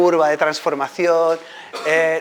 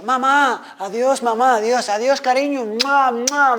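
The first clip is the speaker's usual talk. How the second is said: calling goodbye; 10 semitones higher, slower than usual. Retold or imitated. Calling goodbye to the old lady.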